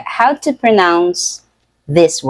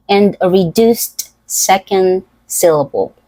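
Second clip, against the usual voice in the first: ordinary talk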